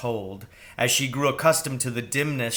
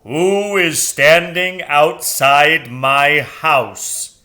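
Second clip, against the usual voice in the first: sonorous owl, very loud and slow; booming owl; 3 semitones higher, 10 dB louder, much slower than usual. Acted. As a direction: boom out of the tree. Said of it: "a voice like an organ tone".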